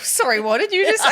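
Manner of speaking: lightly imitated friend's double take; mock incredulous. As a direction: ask it up high in mock shock. Friend's reaction mocked.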